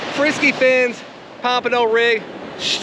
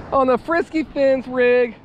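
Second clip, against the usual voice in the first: ordinary talk, nothing marked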